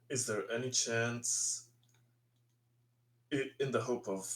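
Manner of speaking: ordinary delivery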